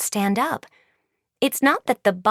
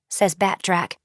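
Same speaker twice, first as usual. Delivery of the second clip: ordinary delivery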